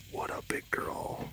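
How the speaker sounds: breathy whispered coo to a pet; whispered pet praise. Real, not acted. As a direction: whisper it to her softly.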